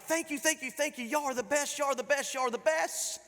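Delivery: high, giddy gushing thanks; a thrilled boy thanking his parents over and over. Retold or imitated. Boy thanking his parents, giddy and high.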